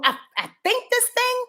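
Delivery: a high, prim voice saying what it thinks; airy know-it-all voice. Retold or imitated. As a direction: play the moon as a heady thinker. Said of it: "When you have the moon".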